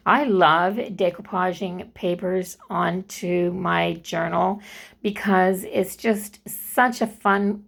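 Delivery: ordinary talk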